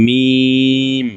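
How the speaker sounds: মিম hummed long on closed dry lips, very slow; dry-lip meem. Shown as a demonstration. Teacher showing the dry-lip মিম to students, long hum. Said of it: "শুকনা অংশ থেকে উচ্চারণ হবে".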